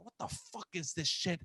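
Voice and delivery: soft, under-the-breath retold grumble; understated muttered annoyance; pissed-off mutter. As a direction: grumble it quietly to yourself. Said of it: "I'm just pissed".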